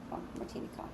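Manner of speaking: soft unvoiced whisper to a pet; whispered coaxing. Real, not acted. Whispering to Martini the pet to come over, hushed coaxing. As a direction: coax the pet in a hush, voice off.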